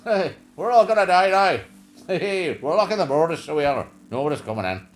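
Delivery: loud, laughing hammed-up Irish doom; mocking the Irish leader closing the borders in a loud, laughing Irish accent. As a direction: shout the Irish doom, so we are. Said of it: "the Irish guy comes on".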